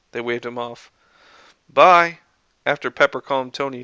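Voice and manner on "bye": loud, higher farewell call. Acted. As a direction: call goodbye across the room. Calling goodbye to Harry.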